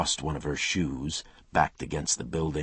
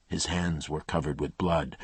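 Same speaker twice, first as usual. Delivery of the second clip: ordinary delivery, nothing marked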